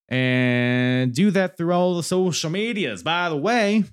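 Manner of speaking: slow, drawled Southern American accent; folksy Southern accent. Real, not acted. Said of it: "don't know where this southern accent came from".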